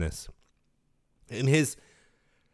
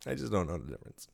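softer, lower, quick aside; quiet admission; 6 semitones lower, 11 dB quieter, faster than usual. Real, not acted. Host apologizing to Australian listeners, hushed quick aside. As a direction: repeat it under your breath, low and quick.

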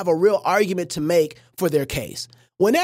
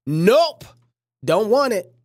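ordinary delivery

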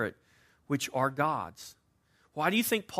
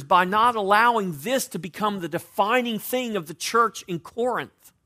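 raised, louder preaching push; 5 semitones higher, 10 dB louder than usual. Acted. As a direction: preach it out to the congregation. Preacher exhorting the congregation.

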